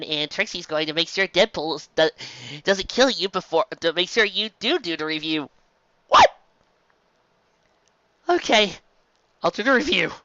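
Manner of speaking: usual speaking voice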